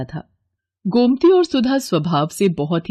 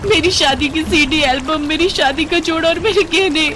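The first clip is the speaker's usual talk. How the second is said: high-pitched, grief-stricken lament; tearful wailing; 8 semitones higher than usual. Acted. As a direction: bawl over lost treasures. Wailing for lost wedding things.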